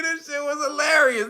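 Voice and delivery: ordinary talk, nothing marked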